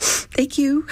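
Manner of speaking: high, squeaky little thank-you; reply in a high, overcome voice; emotional thanks. Retold or imitated. Thanking the general who showed her the crew, high and emotional.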